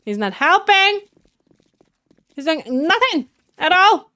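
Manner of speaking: high, loud, indignant protest; exasperated complaint. Real, not acted. Player complains Orb is doing nothing, loudly exasperated. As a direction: protest loudly that you're being left alone. Complains teammate will not help.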